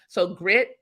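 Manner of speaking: normal talking voice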